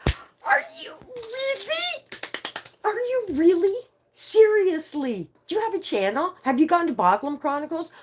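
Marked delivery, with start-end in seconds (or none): 0.1-6.9 high-pitched, stretched delighted squeal, much higher; thrilled, dragging the words out; squealing excitement. Real, not acted. Streamer squeals at a London viewer, excited.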